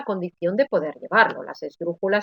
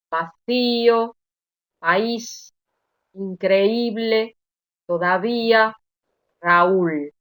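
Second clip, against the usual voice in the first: slow, separate words leaning on í and ú in "Vacío, país, increíble, todavía, Raúl"; stress on weak vowel; much slower than usual. Shown as a demonstration. Teacher reading hiatuses so students notice, weak vowels stressed in "Vacío, país, increíble, todavía, Raúl". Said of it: "para que notéis que es la vocal débil la que tiene mayor intensidad".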